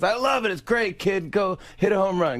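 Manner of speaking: high, excited Sandler voice. Retold or imitated. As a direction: cheer him on in a goofy high voice. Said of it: "He goes".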